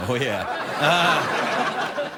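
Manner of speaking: ordinary delivery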